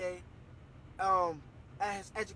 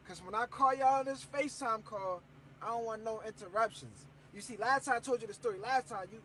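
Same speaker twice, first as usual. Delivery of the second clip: ordinary delivery, nothing marked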